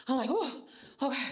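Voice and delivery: breathless from running the stage; gasping between words; out of breath. Real, not acted. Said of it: "I'm out of breath".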